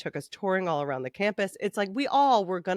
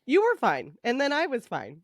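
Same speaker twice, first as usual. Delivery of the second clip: imitating her breezy self; 6 semitones higher than usual. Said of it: "I'm like".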